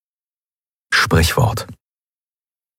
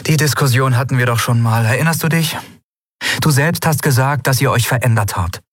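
lightly voiced young heroine, higher and lively; Endriel, higher, griping with a grin; a hint of a fed-up young captain; Endriel's voice; 6 semitones higher than usual. A narrator's reading. Endriel voiced, arguing with Kai.

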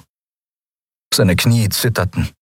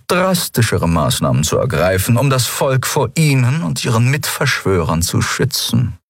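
higher-pitched, measured official voice; cold, composed commodore; 7 semitones higher than usual. Acted. Commodore correcting a prisoner about his work, cold and composed. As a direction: answer with controlled formality.

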